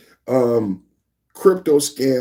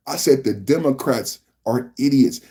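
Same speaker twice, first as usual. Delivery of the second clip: ordinary talk, nothing marked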